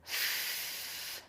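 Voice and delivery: f as a long airy "fff", not the letter name; sustained voiceless "fff" for f; consonant sound F. Shown as a demonstration. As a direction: teeth on lip, let air stream out on f. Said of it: "and it makes the".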